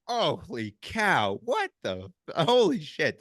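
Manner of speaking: high, drawn-out disbelief; floored by the news; stunned shock. Retold or imitated. Retold shock at Holocaust story.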